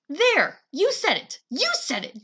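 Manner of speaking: high triumphant shriek. Acted. Brother shrieks in triumph at sister, high and loud. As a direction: catch her out with a yell. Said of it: "Jamie shrieked".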